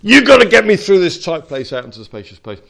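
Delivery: loud desperate plea; pleading cry. Retold or imitated. Believer crying out to God in a tight place, loud. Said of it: "you cry out to God".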